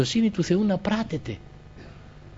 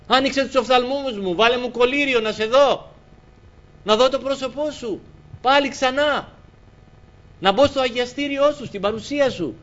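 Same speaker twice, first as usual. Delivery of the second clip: high, loud pleading prayer; fervent prayer; 10 semitones higher, 5 dB louder than usual. Acted. Preacher praying aloud to the Lord, fervent loud plea. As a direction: cry out to God with full fervour.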